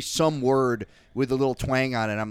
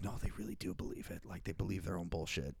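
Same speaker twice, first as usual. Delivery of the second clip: much softer, quick near-whisper; hushed aside; 5 semitones lower, 16 dB quieter, faster than usual. Retold or imitated. Dismayed realisation about true believers, hushed aside. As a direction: drop to a quick, low, barely voiced mutter.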